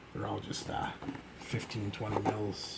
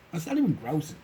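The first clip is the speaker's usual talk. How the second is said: surprised; 6 semitones higher, 6 dB louder than usual. Real, not acted. Reacting to a weak cologne.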